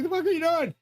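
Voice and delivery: very high-pitched incredulous protest; retold alarmed outburst. Patient challenging doctors mid-operation, high retold outburst of alarm. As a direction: re-enact it with pitch shooting right up.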